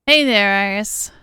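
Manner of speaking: big drawn-out hello across the room; loud greeting. Acted. Greeting Iris as she arrives, loud and slow.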